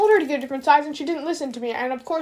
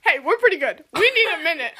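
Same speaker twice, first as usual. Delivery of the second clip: ordinary talk, nothing marked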